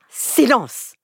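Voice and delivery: sharp angry teacher's order; growled command. Acted.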